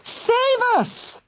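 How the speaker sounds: high desperate plea; screaming. A narrator's reading. Fergal begs his parents to save them. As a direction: scream to be saved. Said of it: "screamed Fergal".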